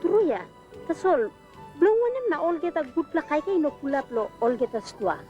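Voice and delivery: ordinary talk, nothing marked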